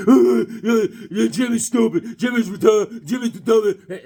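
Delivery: imitating taunting kids behind him; mocking bullies. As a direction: sneer it like a schoolyard bully. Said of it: "making fun of me the whole time".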